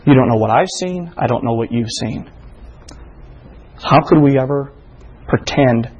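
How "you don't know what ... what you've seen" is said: usual speaking voice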